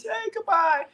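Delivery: put-on squeaky child saying goodbye; high kid voice. Player's little kid saying goodbye, in a squeaky child voice. Player voicing farewell to kids.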